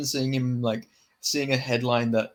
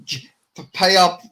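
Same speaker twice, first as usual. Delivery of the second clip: loud, higher mock-Scouse demand; Darren Till; 5 semitones higher, 7 dB louder than usual. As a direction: stammer into a loud Scouse impression. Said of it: "I can imagine Darren Till".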